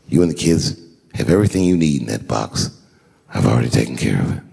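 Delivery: husband soothing his crying wife, low and tender; tender reassurance. Retold or imitated.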